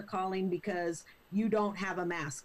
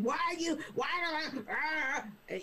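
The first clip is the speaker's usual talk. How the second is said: higher, spluttering imitation of an angry caller; acted-out caller losing the words; mock frothing rage; 7 semitones higher than usual. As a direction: sputter into incoherent put-on fury. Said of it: "frothing at the mouth saying".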